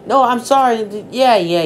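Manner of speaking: flustered apology. Acted. Apologizing to upset mom.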